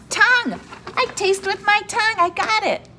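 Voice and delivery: high, loud aha of discovery; excited discovery. Acted. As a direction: cheer as if you just cracked it. Teacher finds the word.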